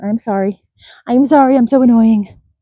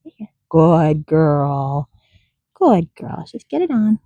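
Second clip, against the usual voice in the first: ordinary talk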